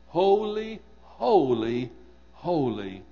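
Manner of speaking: "holy" three times, loud and separate, dropping in pitch after the first; emphatic repetition. Shown as a demonstration. Preacher shows how threefold repetition emphasizes God's holiness, emphatic. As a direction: give each "holy" its own weighty stress. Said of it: "we even do it in our own language".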